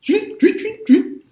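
high chirping tweet sounds; imitating a chirp. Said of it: "das war ja immer so ein Tweet, das Geräusch".

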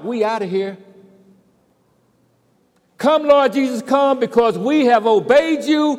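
loud, high, triumphant call; joyful prayer. Acted. Preacher acting the joyful prayer of the finished mission, loud and triumphant. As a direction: shout it out in victory, mission done. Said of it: "the way we ought to be praying that prayer".

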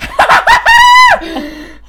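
very high, loud cackle; shrieking laughter. Real, not acted. Girlfriend cracking up at boyfriend's accent, shrieking laughter.

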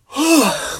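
loud high yawn finally released; big yawn. Real, not acted. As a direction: let out a huge noisy yawn. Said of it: "I got a yawn".

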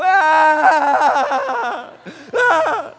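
high, loud laughing wail like Lucille Ball; hammed-up comic wail, half laugh half cry; mock Lucille Ball bawling. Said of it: "she's like Lucille Ball".

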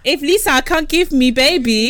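lightly voiced mock husband; imitating a cheating husband. Mimicking the man's excuse.

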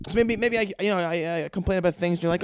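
ordinary talk, nothing marked

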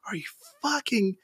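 breathless, high whisper of disbelief; stunned whisper. Retold or imitated. Clerk watching a kid pee in the store, whispering in disbelief. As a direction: mutter it under your breath in shock. Retelling shock at kid peeing.

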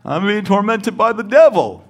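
high, loud mocking whine; complaining believer mocked high and loud. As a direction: parody a tormented believer, loud. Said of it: "I'm always".

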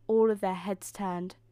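plain reading voice

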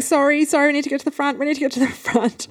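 high, hurried mock panic; frantic apologising. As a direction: re-enact calling out apologies while rushing through. Retold pushing through crowd again.

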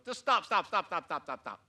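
impatient dad cutting his son off; frustrated parent. Retold or imitated. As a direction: snap at a panicking kid. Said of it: "and I wanna go".

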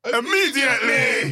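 drawn-out, high wrestling yell; big showman's holler; catchphrase shout. Pretend. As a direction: shout it slowly, high and emphatic. Shouts own catchphrase in intro.

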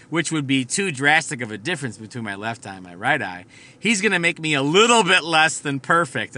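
usual speaking voice

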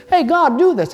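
high, loud and eager, calling on God; eager demand. Retold or imitated. Believer calling boldly on God: loud, high eager demand. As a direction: demand it excitedly. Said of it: "waiting for you to say".

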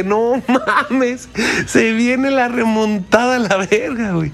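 excited, laughing through it; laughing excitement. Retold or imitated. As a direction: laugh and get hyped. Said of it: "Y dije". (emocionado entre risas)

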